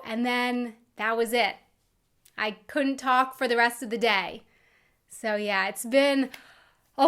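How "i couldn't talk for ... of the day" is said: ordinary talk, nothing marked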